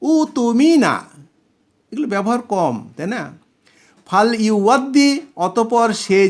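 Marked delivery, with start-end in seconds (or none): 0.0-1.0 উতুমিনা said slowly and higher, each syllable clear; slow model answer. Shown as a demonstration. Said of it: "ইতামানাকে passive করেন কি হবে?".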